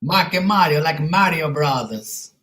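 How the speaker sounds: exaggerated Italian accent, rising "huh?" tags; mock Italian accent. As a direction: play a cartoon Italian cook bragging in a thick Italian accent. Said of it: "My name is Marky Mario".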